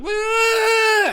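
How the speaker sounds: very high, hammed-up wail; mock bawling. Bachelorette party woman to comedian, mock bawling. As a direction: burst into fake crying. Imitates crying woman at show.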